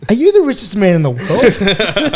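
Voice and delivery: drawn-out, high-pitched amazement; amazed. Retold or imitated. Player marvelling at the car phone owner, amazed. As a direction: gush it slowly, voice lifted in wonder.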